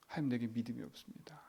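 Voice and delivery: soft, breathy whisper of a humble prayer. Shown as a demonstration. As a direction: whisper it to God, confessing. 속삭이며 기도 시연. (속삭이는 고백 기도)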